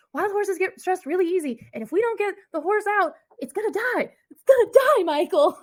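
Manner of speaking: high, pleading warning building to a yell; mock panic. Acting out Tommy warning Michael about the horse, panicked.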